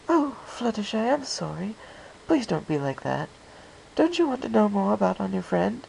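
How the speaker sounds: smooth over a shy friend, a touch genteel; lightly voiced Rarity. Rarity coaxing Fluttershy.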